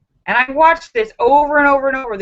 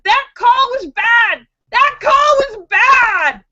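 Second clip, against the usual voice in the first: high, loud, hammered-home protest; indignant shout; 12 semitones higher, 5 dB louder, slower than usual. Real, not acted. Vlogger ranting about the bad call, high indignant shout. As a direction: yell it, outraged at the ref.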